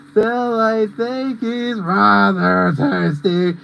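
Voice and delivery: very slow, drawn-out character voice; imitated film clip. Mimicking a clip from Aladdin, slow put-on voice. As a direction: draw every word out in a put-on voice. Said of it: "plays the clip from Aladdin".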